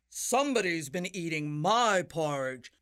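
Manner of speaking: low, loud great bear voice; big papa bear's deep bellow. A narrator's reading. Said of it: "bellowed the great huge papa bear".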